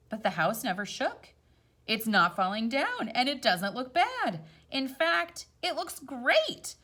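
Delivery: gentle storybook read-aloud; rhyming story voice; reading to children. A narrator's reading. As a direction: read it like a picture book at bedtime. Reads picture book aloud.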